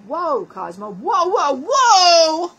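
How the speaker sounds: building from mild to loud alarmed whoa; mock alarm rising to a shout. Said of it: "Angie's gonna be editing, going".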